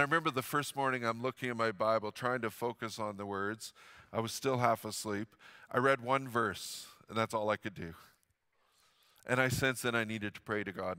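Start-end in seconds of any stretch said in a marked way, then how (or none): none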